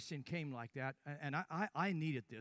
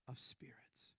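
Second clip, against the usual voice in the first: whisper; 4 semitones lower, 13 dB quieter, slower than usual. Real, not acted. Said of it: "whispered key phrase".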